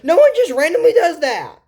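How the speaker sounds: high, loud exasperated protest; excited insistence. Real, not acted. Vlogger protesting that nobody randomly looks up, exasperated. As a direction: throw up your hands at the idea.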